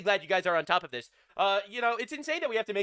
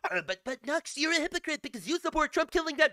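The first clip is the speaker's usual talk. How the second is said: higher whiny parody of a critic; mocking critic voice; 7 semitones higher than usual. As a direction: whine the accusation like a smug opponent. Voicing imagined critic.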